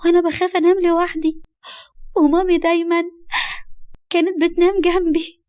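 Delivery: put-on high, upset small-child voice; crying little girl. Little girl who woke up alone, tearful. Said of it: "وهي بتعيط بصوت عالي".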